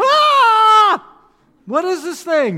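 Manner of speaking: high, loud frightened yell; terrified shout. Retold or imitated. Disciples seeing a figure on the lake, terrified shout. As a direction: scream out in sudden terror. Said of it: "they were terrified".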